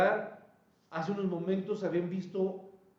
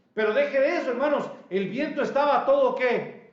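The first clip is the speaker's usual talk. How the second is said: storm-evoking preacher's shout; 6 semitones higher, 7 dB louder than usual. Acted.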